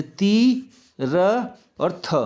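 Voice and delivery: ती, र, थ each on its own, drawn out; sound by sound. Shown as a demonstration. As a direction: break it slowly into ती, र और थ. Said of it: "समझ लीजिए".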